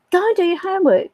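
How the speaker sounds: retold exasperated parental reminder; nagging parent. Parent repeats order, more insistent.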